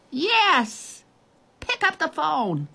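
high, thin faraway shout; distant calling. A narrator's reading. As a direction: call the order from a distance. Girl still shouting from far away.

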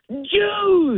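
very high, mock drunken cry; drunk outburst. Drunk answers who "your people" are, high mock outburst. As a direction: imitate a drunk blurting an accusation, voice shooting way up.

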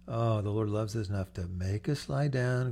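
usual speaking voice

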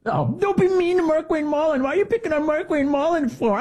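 very high whining falsetto; mocking falsetto pleading; mock whiny defender. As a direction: squeal it in a put-on babyish plea. Mocking those who defend Mullin.

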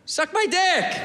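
high, friendly "suck my dick." offer; nice. Shown as a demonstration. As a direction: say "suck my dick." sweetly and politely. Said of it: "We should say it nice".

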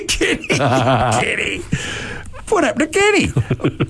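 high cartoon mock-lament; squeaky cartoon cry for Kenny; South Park voice. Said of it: "Like Kenny from South Park".

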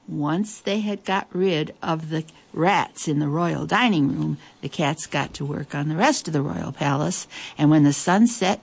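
ordinary delivery, nothing marked

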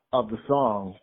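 usual speaking voice

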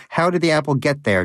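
indignant shout. A narrator's reading. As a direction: press him hard. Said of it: "Donut shouted".

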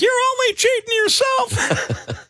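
high-pitched indignant holler; retold heckling yell. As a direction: call out after the rider, voice way up. Retold heckling of passing e-biker.

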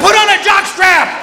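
high, slow yelled comeback; shouted retort. Retold or imitated. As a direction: yell back at the guide.

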